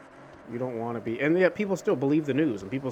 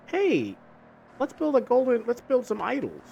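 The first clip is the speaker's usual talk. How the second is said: a lightly voiced fickle crowd, higher and eager; chirpy higher voice for careless people; fickle Israelites; 12 semitones higher, 4 dB louder than usual. Retelling the Old Testament, voicing the Israelites as eager and higher.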